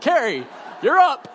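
loud, high summoning shout; calling out. Retold or imitated. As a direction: call her up like the next player. Calling the next woman over.

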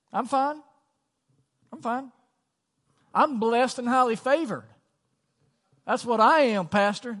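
high, loud, chirpy "I'm fine" act; fake cheerful churchgoer. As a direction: play the too-blessed church member brushing it off. Mock churchy cheerful answer.

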